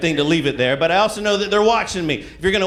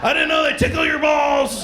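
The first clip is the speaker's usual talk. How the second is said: amazed; 10 semitones higher than usual. Retold or imitated. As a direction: shout in sudden discovery. Android user's surprise.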